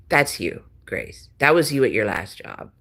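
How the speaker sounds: ordinary talk, nothing marked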